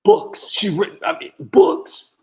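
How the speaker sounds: sudden very high, loud disbelief, then breathy; incredulous squeak. Real, not acted. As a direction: squeak the word out in total disbelief. Incredulous at her book deals.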